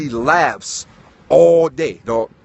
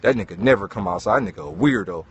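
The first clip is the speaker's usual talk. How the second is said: gossiping neighbor; 8 semitones lower than usual. Retold or imitated. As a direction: murmur it like a nosy neighbor. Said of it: "everybody in the neighborhood like".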